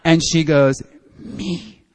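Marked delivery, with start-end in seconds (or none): 1.2-1.8 slow hushed declaration; slow whisper telling him she's his sponsor. Retold or imitated. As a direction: whisper it like a promise. Whispered reply during meeting.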